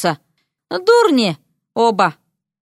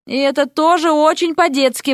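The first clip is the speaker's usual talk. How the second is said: higher, exasperated retort; raised reproachful call; called after her; 6 semitones higher than usual. A narrator's reading. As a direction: throw it after her as she leaves. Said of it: "бросил ей вслед Эрик".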